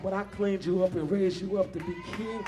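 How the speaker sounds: the Lord scolding an ungrateful king; God rebuking Saul. Acted. The Lord reproaching King Saul, building to an indignant rebuke. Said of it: "the Lord told Saul".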